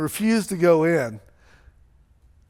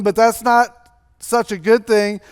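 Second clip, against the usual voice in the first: normal talking voice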